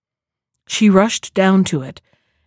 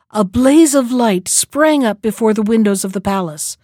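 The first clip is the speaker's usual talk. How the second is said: ordinary delivery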